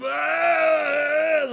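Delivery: high, loud mock dying groan. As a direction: groan like a half-dead man staggering up. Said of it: "he's making a lot of noise".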